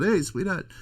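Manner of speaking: Italian-style "Hey, sweetheart" sung out with a high rising lilt; Italian greeting. Retold or imitated. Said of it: "Italians kinda talk like that".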